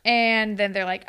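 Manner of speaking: ordinary talk, nothing marked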